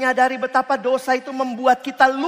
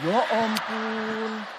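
sighed aside; 8 dB quieter, much slower than usual. Real, not acted. Sighing aside.

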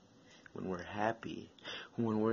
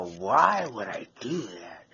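usual speaking voice

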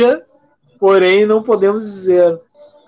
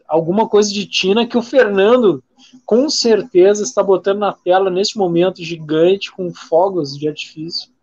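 usual speaking voice

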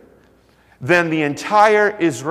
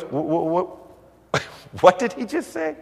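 stunned disbelief; 3 semitones higher than usual. Retold or imitated. Young David stunned at Goliath's taunt, stammering in disbelief. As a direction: stutter out the question, amazed. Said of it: "And David goes".

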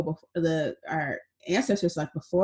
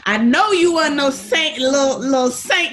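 high, loud teasing sing-song; loud, high, mock-accusing tease; playful teasing; 8 semitones higher, 7 dB louder than usual. Real, not acted. Teasing host about being no saint.

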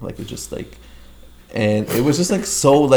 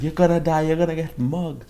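dramatic; 6 semitones higher than usual. Retold or imitated. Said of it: "they're so dramatic".

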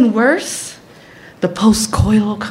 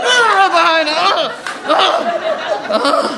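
mock choking grunts; 10 semitones higher than usual. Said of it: "I'd have been like".

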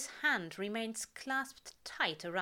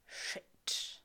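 soft unvoiced hiss; 4 dB quieter than usual. A narrator's reading. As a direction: hiss the curse under breath. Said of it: "Crowley hisses".

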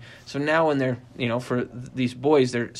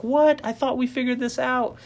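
high-pitched mock disbelief; baffled owner squeaking in disbelief; incredulous dismay; 11 semitones higher than usual. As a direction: act out exasperated surprise, pitched way up. Dog owner's baffled reaction, acted.

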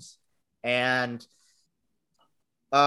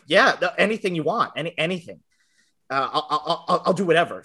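louder, higher nervous begging; panicked pleading; 4 semitones higher, 6 dB louder than usual. Retold or imitated. A scared kid promising the bully anything, tripping over words. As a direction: beg him, stumbling over every word. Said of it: "And I was like".

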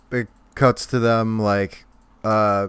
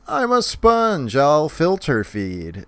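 high, drawn-out cartoon retort; cartoon sponge quote; 6 semitones higher, slower than usual. SpongeBob answering a worry about eating, imitated high and drawn out. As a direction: sing out a mock-cartoon answer, stretching the words. Acting out SpongeBob's cheerful reply.

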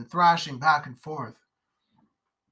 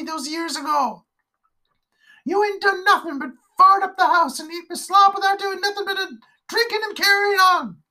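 high, loud furious scolding; a wife berating her useless husband; shrill scolding wife; 13 semitones higher, 4 dB louder than usual. Acted.

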